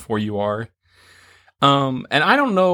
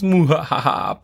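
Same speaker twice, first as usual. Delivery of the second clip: evil cackle; slower than usual. Pretend. Evil laugh after pies.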